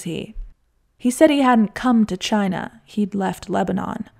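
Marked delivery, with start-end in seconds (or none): none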